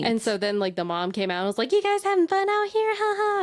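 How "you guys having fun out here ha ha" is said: fake cheerful mom. Mom quoted, awkward.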